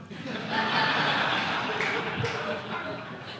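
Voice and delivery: a long breathy laugh with no voice. Real, not acted. Laughs at his own story.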